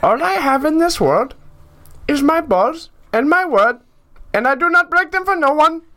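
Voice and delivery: put-on high, loud Middle Eastern accent, lisping the s. Reading a movie quote for friends in a Middle Eastern accent with a lisp. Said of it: "can I get a repeat".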